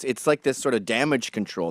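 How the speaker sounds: ordinary talk, nothing marked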